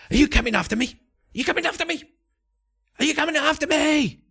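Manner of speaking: shrieking, unhinged hermit rising into panic; crazed monk. Acted. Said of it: "he stops and turns and goes".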